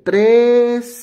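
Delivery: tres called out high and loud; counting aloud. Shown as a demonstration. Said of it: "voy a contar tres". (contando tres en voz alta)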